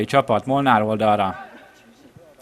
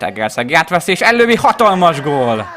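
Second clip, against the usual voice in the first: rising into a roaring goal shout; excited goal call; 7 semitones higher, 8 dB louder than usual. Real, not acted. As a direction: explode as the ball hits the net. Goal scored, commentator erupts. (izgatott gólkiáltás)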